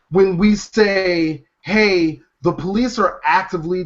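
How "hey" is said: normal talking voice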